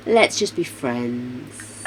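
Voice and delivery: gentle letdown. Acted. Voicing the rejection.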